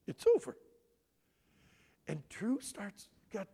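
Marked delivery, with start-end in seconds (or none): 0.1-0.6 imitating dad. Dad's voice in retold memory.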